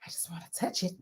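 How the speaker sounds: soft breathy whisper of want; whispered longing. Retold or imitated. Son whispering at the McLaren, longing whisper. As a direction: whisper it like a kid who can't resist. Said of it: "he's like".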